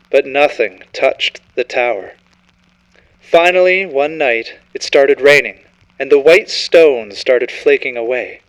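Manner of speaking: plain reading voice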